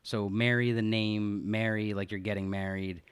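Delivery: ordinary talk, nothing marked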